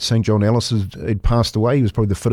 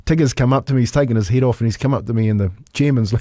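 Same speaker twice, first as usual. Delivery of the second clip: ordinary delivery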